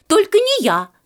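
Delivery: high, loud second refusal; shouted refusal. A narrator's reading. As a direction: cry out to get out of work. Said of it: "закричал другой". (громко и высоко отнекивается)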